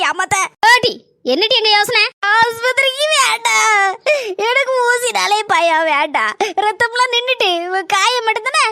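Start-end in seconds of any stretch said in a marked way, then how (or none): 2.3-6.3 slow, tearful plea; fearful pleading. Acted. Injured woman begging the old lady: no hospital, slow and tearful. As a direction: beg not to go, half crying.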